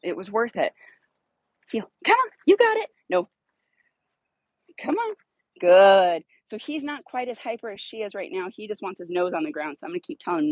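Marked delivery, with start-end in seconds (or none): 2.0-5.2 much higher coaxing call. Real, not acted. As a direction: coax the dog along up high.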